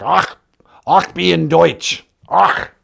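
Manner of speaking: throat-clearing German "ach" sounds; phlegmy mock German. Shown as a demonstration. Man shows why German makes him spit, guttural mock German. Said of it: "I get phlegm in my throat".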